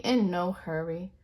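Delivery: plain reading voice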